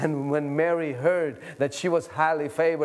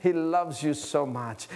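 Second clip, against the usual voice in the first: normal talking voice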